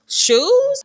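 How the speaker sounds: high, disbelieving squeak upward; incredulous question. Retold or imitated. Reacting to friend going back for shoes, incredulous.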